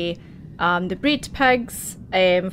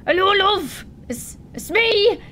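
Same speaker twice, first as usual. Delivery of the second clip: high, slow sing-song greeting to his wife; Steve's cheery hello; 11 semitones higher, slower than usual. Acted. Husband calling sweetly to his wife on arriving home. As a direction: call out high and sweet, bracing for trouble. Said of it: "he's like".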